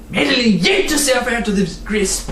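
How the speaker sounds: plain reading voice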